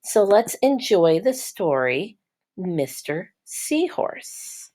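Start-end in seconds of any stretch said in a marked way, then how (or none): none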